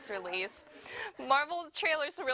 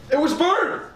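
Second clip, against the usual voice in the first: loud accusation; 3 semitones higher, 12 dB louder than usual. Acted.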